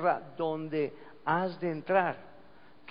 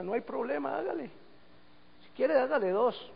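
obliging palm tree; 8 semitones higher than usual. Acted. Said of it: "dice la palma".

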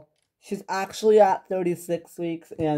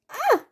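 choked laugh-sob; 2 semitones higher than usual. Acted. Father breaks down.

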